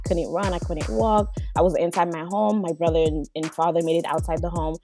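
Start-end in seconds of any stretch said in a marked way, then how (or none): none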